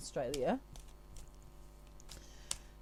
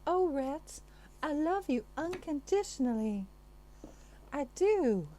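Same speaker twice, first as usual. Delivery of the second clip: high, swooning Southern belle imitation declaring love; Gone with the Wind heroine; 7 semitones higher than usual. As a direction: overplay a melodramatic old-film Southern belle, much higher. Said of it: "Like Gone with the Wind".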